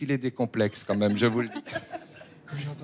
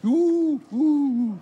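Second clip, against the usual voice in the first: high, loud "ooh" whoops; two drawn-out high whoops; excited whooping; 14 semitones higher, 5 dB louder than usual. Acted. Whooping for the show's start.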